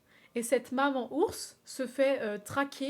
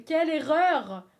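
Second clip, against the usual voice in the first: loud, higher and slow; loud dramatic lament; 4 semitones higher, 9 dB louder, slower than usual. Acted. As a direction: wail the words out slowly.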